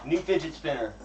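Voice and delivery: ordinary talk, nothing marked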